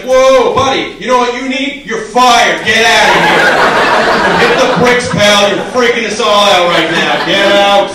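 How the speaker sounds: loud mock firing tirade; angry boss. Boss firing a guy who cries at work, loud tirade. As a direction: throw him out like an alarmed boss. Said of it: "The boss comes by, he's like".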